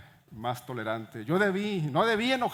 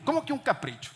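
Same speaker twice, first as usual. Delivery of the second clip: imitating an indignant outburst, pitched up; retold angry retort; 7 semitones higher than usual. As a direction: replay your own indignant snap at the manager. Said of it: "ya enojado y alterado le dije". (reproche indignado, imitado)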